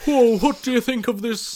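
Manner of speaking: high, loud hammed-up British accent; mock UK accent. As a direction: caricature a British pub bore. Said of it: "like, U K accent".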